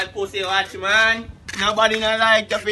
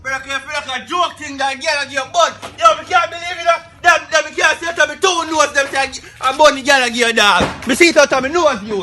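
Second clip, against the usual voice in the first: shouting; 6 semitones higher, 3 dB louder than usual. Acted. Shouting disbelief about the girl.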